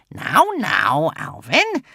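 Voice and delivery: calm him with a sly warning; cautioning witch. Acted. Witch calming Alvin, cautioning witch voice. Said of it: "cautioned the witch".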